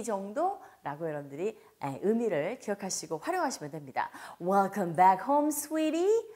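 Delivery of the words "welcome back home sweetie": warm welcome. Shown as a demonstration. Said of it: "이렇게 따뜻하게 맞이하겠죠".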